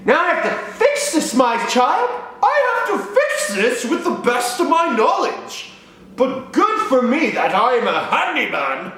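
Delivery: dad taking charge of the broken pipe; pompous dad. Retold or imitated. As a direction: play a proud handyman hero. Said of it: "He's like".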